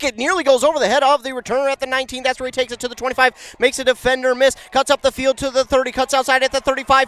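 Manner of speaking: fast, loud, high-pitched live call; excited play-by-play. Acted. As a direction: ride the return yard by yard. Live kickoff return call.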